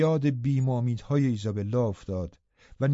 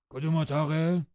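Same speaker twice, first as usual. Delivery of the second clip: higher-pitched surly servant voice; Joseph the dour servant; 4 semitones higher than usual. A narrator's reading. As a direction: ask curtly, in a put-on servant voice.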